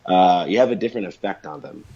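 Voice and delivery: usual speaking voice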